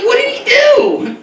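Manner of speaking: put-on high, slow, wide-eyed little kid asking; a high piping child, puzzled; small child voice. Young boy in church asking his mother, high and slow. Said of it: "I'm like".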